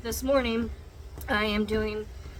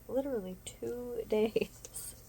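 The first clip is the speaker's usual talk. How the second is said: whispering; 10 dB quieter, slower than usual. Real, not acted. Whispered laughing aside.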